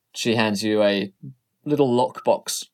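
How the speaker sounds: normal talking voice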